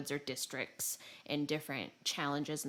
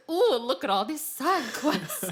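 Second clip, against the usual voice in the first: hammed-up wonder; 7 semitones higher, 7 dB louder than usual. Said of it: "And I was like".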